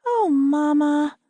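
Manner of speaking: put-on high, slow childish whine of letdown; two daughters disappointed, drawn-out Oh Mama; disappointed little girls. A narrator's reading. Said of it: "disappointment palpable".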